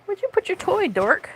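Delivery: high-pitched question to a pet; playful pet talk. Real, not acted. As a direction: quiz the cat in a high voice. Teasing cat about lost toy.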